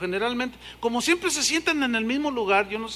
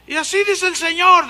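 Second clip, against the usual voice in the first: mock prophecy; 9 semitones higher, 6 dB louder than usual. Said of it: "decía con el entendimiento".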